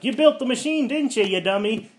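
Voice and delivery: upset scolding. Retold or imitated. Sue scolds Reed over machine, shrill mock accusation. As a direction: accuse him like an upset wife. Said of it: "Sue's upset".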